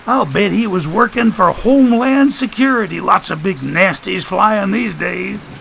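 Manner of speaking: high sardonic character voice; high-pitched mock speculation; wisecracking Joshua. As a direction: toss out a quip in a high voice.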